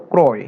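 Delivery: ক্রয় with a closed o, "kroy"; hypothetical o vowel. Shown as a demonstration. Teacher showing how it would wrongly sound, o-vowel ক্রয়. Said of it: "ওর মতো হতো".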